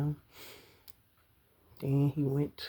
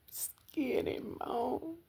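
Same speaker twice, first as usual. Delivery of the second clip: tearful whisper; 12 semitones higher, 4 dB quieter than usual. Real, not acted. Tearful memory of dying man.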